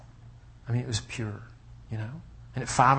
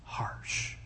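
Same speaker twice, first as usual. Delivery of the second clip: hushed echo; 12 dB quieter than usual. Shown as a demonstration. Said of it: "echoing the word quietly".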